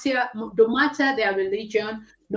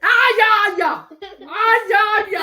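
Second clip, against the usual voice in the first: high, loud happy yeah; excited cheer; 11 semitones higher, 8 dB louder than usual. Real, not acted. Cheering about own kindness.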